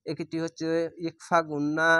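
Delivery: ordinary talk, nothing marked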